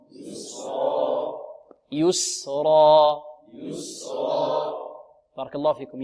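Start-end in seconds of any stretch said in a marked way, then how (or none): 0.1-1.4 "يسرا" whispered slow with open throat. Shown as a demonstration. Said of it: "الحلق يبقى مفتوح". 1.9-3.2 "يسرا" slow, held, heavy ra; slow model. Shown as a demonstration. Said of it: "انطقوا معي". 3.5-4.7 "يسرا" whispered slow with open throat. Shown as a demonstration. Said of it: "الحلق يبقى مفتوح".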